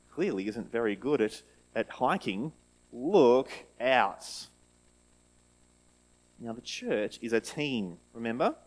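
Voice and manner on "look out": calling out. Shown as a demonstration. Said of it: "you're calling out to this person".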